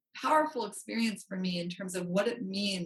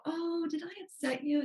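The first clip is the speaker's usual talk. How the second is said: timid apology; 8 semitones higher than usual. Shown as a demonstration. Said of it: "I didn't just go".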